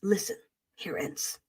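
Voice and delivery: low, hushed mock menace; soft warning with long dramatic pauses; mock threat. Host restarts warning to superchat donor, hushed and mock-solemn.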